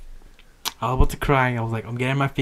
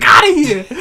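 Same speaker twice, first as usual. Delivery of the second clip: high, loud outburst with a laugh; exaggerated indignant shout; mock angry yell; 14 semitones higher, 10 dB louder than usual. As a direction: holler them out of the room, hammed-up. Mock outburst at a ringing phone.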